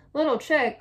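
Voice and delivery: storybook mother calling out. A narrator's reading. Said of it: "called Mama".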